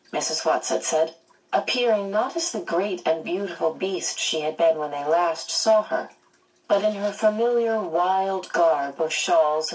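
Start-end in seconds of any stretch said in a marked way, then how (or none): none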